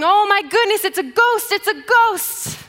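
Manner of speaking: loud, high-pitched cry of alarm; panicked yelling. Acted. Disciples spotting a figure on the water, panicked yelling. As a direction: yell it out like you've seen something terrifying. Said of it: "They start yelling".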